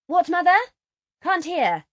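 high, loud call to mother; calling upstairs. A narrator's reading. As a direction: shout up that you missed it. Laura calls up to her mother.

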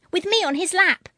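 put-on high, loud little-girl voice; eager child. A narrator's reading. Girl telling siblings she'll sit on father's lap, high eager. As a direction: bid for daddy's lap like a small child.

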